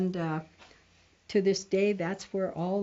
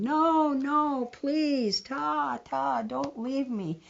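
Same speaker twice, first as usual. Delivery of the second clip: higher and louder, a hammed-up pleading cry; desperate holler; 7 semitones higher, 4 dB louder, slower than usual. Brother running after his leaving sister, desperate pleading holler. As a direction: call her back in a panic. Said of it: "he hollered and ran".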